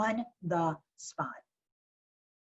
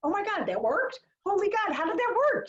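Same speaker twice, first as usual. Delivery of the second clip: higher, playfully astonished exclaim; amazed; 10 semitones higher than usual. Pretend. Owner telling everyone about a success, mock amazed. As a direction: act thrilled it worked. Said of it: "Now tell everyone".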